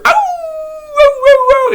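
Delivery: high howled ow, ow; coyote howl imitation. Hiker imitating a coyote howl. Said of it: "I hear a slightly louder".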